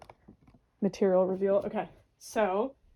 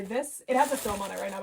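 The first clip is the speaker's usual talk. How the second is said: ordinary talk, nothing marked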